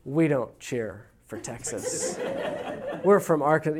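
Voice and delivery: slow, chuckling mock scold; half-laughing, slow fatherly correction; laughing through dad's line. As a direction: lay down the family rule while cracking up. Dad's reply told through laughter.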